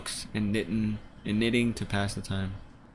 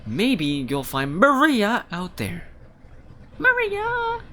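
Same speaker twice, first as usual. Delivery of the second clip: high, slow loud plea; high character plea; 12 semitones higher, 5 dB louder, much slower than usual. Acted. A villager urging Anatoly to search for Maria, high slow plea. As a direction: coax him with a hopeful call of the name.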